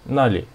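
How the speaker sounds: nali with a falling fourth tone on na; short falling take of nali. Shown as a demonstration. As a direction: drop sharply on na in nali. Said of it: "This one is fourth tone".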